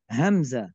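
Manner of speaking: hamzah with a glottal catch, falling low; corrected hamzah. Shown as a demonstration. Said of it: "لا، ليس حمزة". (همزة مصححة)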